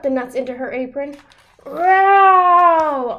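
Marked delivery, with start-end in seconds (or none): 1.6-3.2 loud roaring bear; loud storybook bear roar. A narrator's reading. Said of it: "Old Bear roared".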